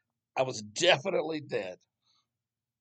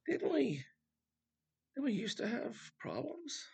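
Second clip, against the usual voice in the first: much higher, softly puzzled recollection; hesitant high-pitched musing, as if barely remembering; mock puzzled wondering; 12 semitones higher, 6 dB quieter than usual. People in a trouble-free time vaguely recalling problems, mock puzzled. Imagined puzzled chat in painless future.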